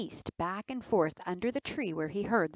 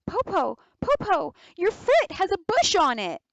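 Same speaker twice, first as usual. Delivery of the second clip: put-on alarmed child; 11 semitones higher, 8 dB louder than usual. Girl alarmed touching the tail.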